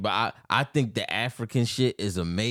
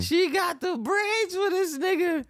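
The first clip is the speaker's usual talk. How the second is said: high mock squeal; slower than usual.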